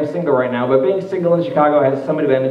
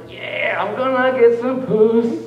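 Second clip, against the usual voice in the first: excited; 8 semitones higher, slower than usual. Retold or imitated. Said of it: "I'm like, all excited".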